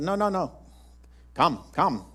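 imitating Philip's South Indian accent; mild South Indian lilt on the invitation. Pastor imitates Philip's accent welcoming him in, lightly South Indian. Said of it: "That's the way Philip talked".

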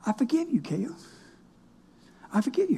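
soft pardon under the breath; murmured blessing. Retold or imitated. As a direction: repeat it quietly like a prayer. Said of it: "say subliminal".

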